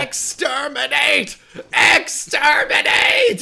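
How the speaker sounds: high, slow robotic shout; overacted anime dub. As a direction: screech it like a Dalek. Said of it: "which he does yell in the dub version, straight up, like, Doctor Who reference".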